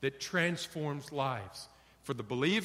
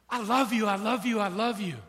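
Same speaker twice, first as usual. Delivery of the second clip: louder, repeated I love you; dismissive husband; 4 semitones higher, 4 dB louder, faster than usual. Retold or imitated. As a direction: shut it down with love. Husband repeating same answer.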